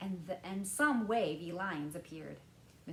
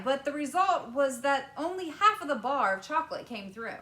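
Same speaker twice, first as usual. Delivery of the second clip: louder, higher Wonka, speeding up; lightly voiced apologetic Wonka; 8 semitones higher, 13 dB louder than usual. A narrator's reading. Wonka explaining the danger to the parents. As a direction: explain the mishap, a bit hurried.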